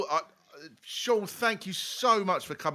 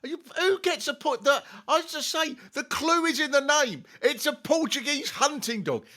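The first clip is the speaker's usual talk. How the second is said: rising rant; 11 semitones higher, 3 dB louder than usual. Real, not acted. Ranting about hunting dog.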